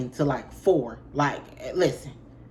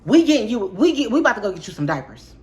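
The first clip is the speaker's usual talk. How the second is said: exasperated threat; 5 semitones higher, 6 dB louder, faster than usual. Retold or imitated. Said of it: "I was about to say".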